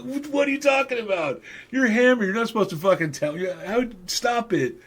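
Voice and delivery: mock-outraged high yelp, laughing at himself; flustered protest. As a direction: squawk it out like you've been caught red-handed. Caught out, protesting.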